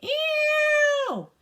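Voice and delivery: high, loud held squeal; hammed-up squealing scream; hog scream. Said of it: "who screamed".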